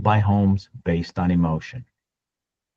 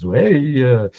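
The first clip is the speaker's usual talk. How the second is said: Italian impression; 5 dB louder than usual. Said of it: "Italians are really good at emotional talking".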